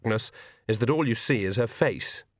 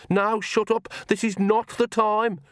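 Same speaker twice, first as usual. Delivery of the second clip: high-pitched, insistent scolding; exasperated; 10 semitones higher, slower than usual. Acted. Agnes scolds her other self, high and exasperated. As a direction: silence someone urgently in a raised pitch.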